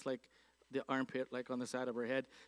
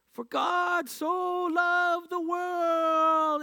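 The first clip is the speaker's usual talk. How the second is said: put-on high, loud, drawn-out little girl's cry; tiny child preaching; 12 semitones higher, 8 dB louder, much slower than usual. Small girl shouting scripture to a busy street corner, high and loud. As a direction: belt it out slowly like a tiny girl on a soapbox. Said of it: "like a four-year-old with this tiny little voice, she'd yell out".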